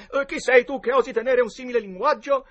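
high, loud declaimed challenge; recited challenge. A narrator's reading. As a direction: declaim the memorised line defiantly. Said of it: "secondo le regole, a memoria". (sfida declamata a gran voce)

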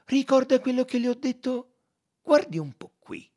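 high, loud detective's triumph; exclaiming. A narrator's reading. Detective shows his friend the find, exclaiming loudly. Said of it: "esclamò e rivolgendosi a Monsieur Bouc".

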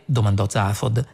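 plain reading voice

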